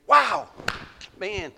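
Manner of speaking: high, loud burst of wonder; amazed exclamation. Acted. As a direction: blurt it out in awe. Preacher voices Jonah's reaction.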